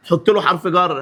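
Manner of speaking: normal talking voice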